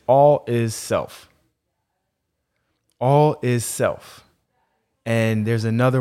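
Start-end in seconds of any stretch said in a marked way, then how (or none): 0.1-1.3 slow, deliberate, each word weighted; slow and weighty. Acted. States his guiding maxim. 3.0-4.2 slow, deliberate, each word weighted; slow and weighty. Acted. Repeats the maxim.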